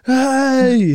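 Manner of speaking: high, loud warning call. Retold or imitated. Friend calling across McDonald's to stop him, loud. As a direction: shout across the room to warn.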